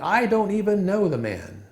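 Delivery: high, emphatic mock yell; retold heated yell. Peter heated with those in the courtyard, imitated yell. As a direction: deny it hotly, pitch thrown up. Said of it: "he gets heated ... yelling".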